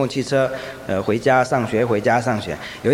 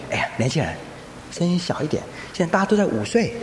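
put-on old man hushing; 7 dB quieter than usual. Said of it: "旁边一个老先生说".

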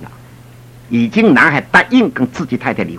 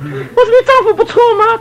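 laughing tease; slower than usual. Retold or imitated. Teasing question to wife.

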